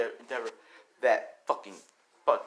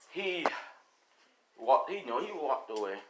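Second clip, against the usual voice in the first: usual speaking voice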